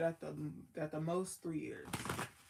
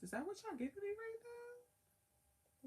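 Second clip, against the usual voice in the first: much higher and softer, drawn out; incredulous question; 7 dB quieter than usual. Real, not acted. Reader asking the spirits about the cards, incredulous high. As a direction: squeak it out in disbelief at the cards.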